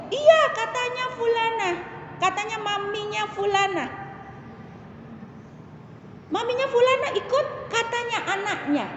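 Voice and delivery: high mock mother's voice; retold gossiping mother. Mother cites another mother's word, high imitation.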